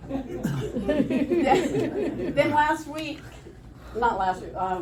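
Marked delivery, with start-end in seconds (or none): 0.1-3.2 laughing. Real, not acted. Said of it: "laughing while resuming".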